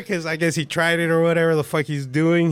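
ordinary delivery

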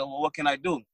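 normal talking voice

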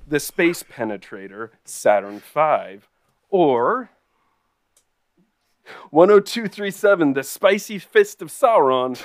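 loud hyped game-show reading; excited announcing. Acted. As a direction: announce the choices like a prize show.

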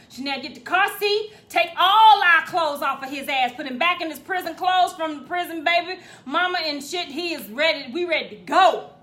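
loud, excited rush to leave; loud eager orders. Real, not acted. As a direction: hurry everyone out loudly. Ordering daughter to pack up.

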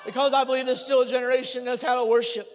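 loud, high impassioned shouting; preaching at full force. Acted. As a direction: build to a roaring altar call.

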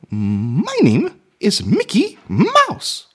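very slow, high falsetto cartoon mouse; Mickey Mouse, drawn out. Shown as a demonstration. Man finding the tones of his impression, slow high mouse voice. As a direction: stretch each word in a squeaky falsetto. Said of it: "That's my key in".

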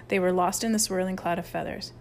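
ordinary delivery, nothing marked

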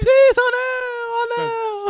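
high, slow crying wail; panicked cry. Pretend. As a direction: shriek in mock panic. Mock panic.

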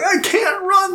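high, whiny mock sob; mock crying. Wounded soldier whining to his squad, high mock sob. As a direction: whimper it like a wounded baby. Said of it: "He's, like, crying".

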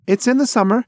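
high, loud, eager insistence; eager blurt. Acted. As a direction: blurt it out so he still gets a present. Pigeon blurts birthday.

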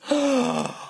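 high, sharp mock gasp of a shocked crowd. Imitating the shocked room in Oliver, a high gasp. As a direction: gasp like a whole room scandalised. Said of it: "And the whole place goes".

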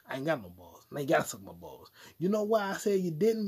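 ordinary talk

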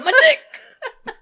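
high, loud squeal with laughter; mock alarm cry. Echoing co-host's fire alarm joke, laughing mock wail. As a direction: echo the wail, cracking up.

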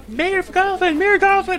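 high-pitched call for the mayor; urgent yelling. Acted. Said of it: "from behind you guys yelling".

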